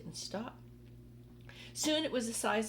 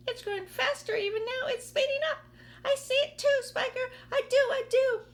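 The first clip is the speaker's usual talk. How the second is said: very high, loud, frantic cries; excited shouting aunts; 8 dB louder than usual. Acted. The two aunts shrieking as the peach speeds up, very high loud cries. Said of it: "Aunt Spiker shouted".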